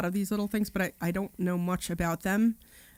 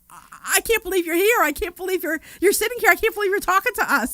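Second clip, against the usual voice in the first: higher, louder awestruck gush; starstruck disbelief; 12 semitones higher, 6 dB louder than usual. Retold or imitated. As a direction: gush in disbelief at meeting them. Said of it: "they're like".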